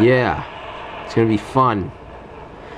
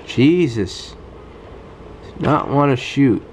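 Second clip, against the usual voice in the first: normal talking voice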